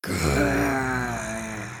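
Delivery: long, deep ogress growl; low growl. Acted. Mama Draga angered by Angelina's insults, growling. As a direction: rumble low in the throat. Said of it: "Mama Draga growled".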